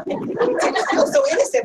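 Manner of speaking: high, slow, sweet innocent tone; mock innocent voice, pitched high; innocent sing-song. Real, not acted. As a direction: say it as if it were harmless and nice. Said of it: "you said it so nicely".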